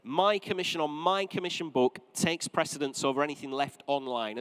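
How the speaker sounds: normal talking voice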